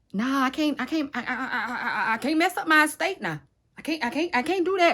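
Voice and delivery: high, stammering backpedal; mock panic, tripping over repeated words; flustered refusal. Relative refusing to risk his estate, flustered.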